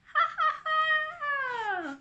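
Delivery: slow, pealing acted ha-ha; high loud long laugh. A narrator's reading. Anna laughing in the waves, loud laugh. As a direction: laugh out with full glee. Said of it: "laughs Anna".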